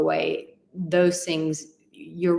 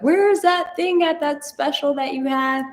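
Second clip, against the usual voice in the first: confused viewer; 9 semitones higher, 4 dB louder than usual. Retold or imitated. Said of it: "you're like".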